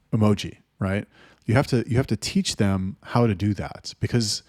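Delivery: ordinary delivery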